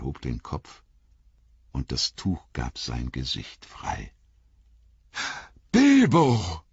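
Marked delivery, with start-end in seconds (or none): 5.7-6.6 high, loud cry of recognition; shouting the old friend's name, overjoyed; joyful shout. A narrator's reading. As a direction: call out his name in delight. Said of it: "rief Frodo".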